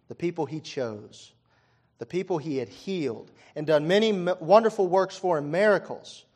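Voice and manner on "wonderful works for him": ordinary delivery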